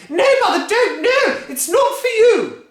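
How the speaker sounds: very high, loud no; panicked cry. Acted. Missus Cranky stopping Grandma, panicked high cry. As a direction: cry out in alarm.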